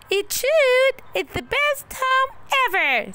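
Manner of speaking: put-on high, loud happy puppet boy; delighted puppet. A narrator's reading. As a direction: cheer like a happy little boy. Pinocchio delighted.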